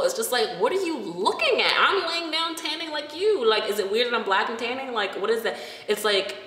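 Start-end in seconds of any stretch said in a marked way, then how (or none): 0.6-5.6 higher, indignant mock challenge; annoyed retort. To staring beachgoers, an indignant retort. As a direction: throw it back at the starers.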